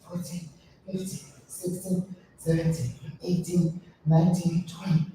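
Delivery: soft, breathy rep count; counting under breath. Real, not acted. Trainer counting her reps softly during the exercise. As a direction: count the reps quietly while working.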